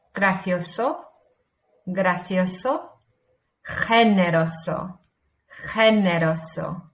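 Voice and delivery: careful Spanish pronunciation for learners to copy; Spanish accent. Shown as a demonstration. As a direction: read each word out slowly in a clear Spanish accent. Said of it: "in your best Spanish accent".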